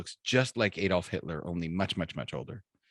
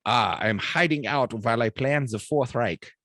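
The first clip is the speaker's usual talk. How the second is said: put-on comic German-accented old dictator; joke-teller's mock German voice; Adolf Hitler. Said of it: "And Hitler's like".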